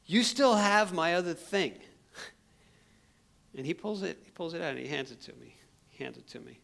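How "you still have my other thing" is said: demanding. Retold or imitated. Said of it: "And I'm like".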